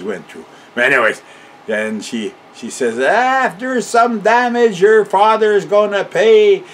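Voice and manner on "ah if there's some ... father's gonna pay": high, loud scolding yell; grandmother yelling. Retold or imitated. Grandmother yelling at the boys over her loose chickens, angry. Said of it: "And she's yelling at us".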